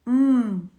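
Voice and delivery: "Hum" as one held closed-lip mmm; isolated M sound. Shown as a demonstration. Teacher showing children the starting sound, isolated hummed M. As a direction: sound only the phoneme of "Hum", a humming M. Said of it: "iniciam com o sonzinho".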